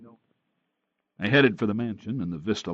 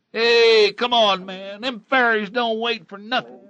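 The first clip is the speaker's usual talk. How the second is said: impatient driver; 14 semitones higher, 8 dB louder than usual. Acted. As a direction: shout for him to hurry up. Van driver calls out.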